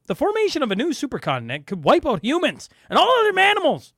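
mocking exaggerated read. Shown as a demonstration. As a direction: read it like it's absurd. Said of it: "I'm going to say that again".

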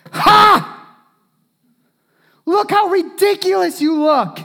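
loud, high mocking laugh and jeer; open mockery. Mocking the person who walks in, loud and high. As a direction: sneer right in their face. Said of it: "we don't say it like that".

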